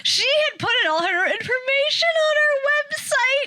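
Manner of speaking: very high, squeaky sales voice; squeaky saleslady. Retold or imitated. Mom mimicking the caller, very high and squeaky. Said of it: "And she goes".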